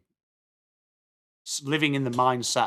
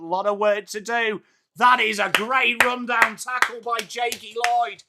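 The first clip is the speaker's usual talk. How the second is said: excited running commentary, pitched up; live play-by-play; 6 semitones higher, 3 dB louder than usual. Real, not acted. Streamer calling an inside fifty for viewers, excited commentary. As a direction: keep the call lifted right through to the tackle.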